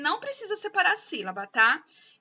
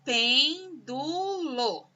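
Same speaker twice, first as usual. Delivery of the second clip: "Pêndulo" split apart very slowly: pen... du... lo; separating syllables for pupils; much slower than usual. Shown as a demonstration. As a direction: spell out the syllables at a crawl. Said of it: "eu até separei a sílaba".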